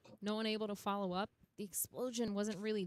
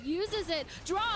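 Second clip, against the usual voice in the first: rising, hyped shout-call; grenade warning yell; 9 semitones higher, 5 dB louder, slower than usual. Real, not acted. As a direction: yell it as the nades drop. Caster calls grenade drop live.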